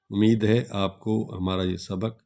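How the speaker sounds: ordinary talk, nothing marked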